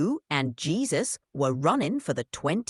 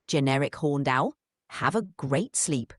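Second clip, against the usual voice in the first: ordinary talk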